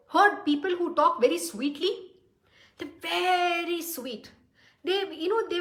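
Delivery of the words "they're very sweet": drawn out slowly, syrupy sweet; slow, sugary imitation of a sweet talker; mock sweet voice. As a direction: coo it like a flatterer would.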